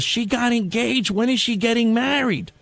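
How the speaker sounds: put-on slow, high-pitched nosy old lady.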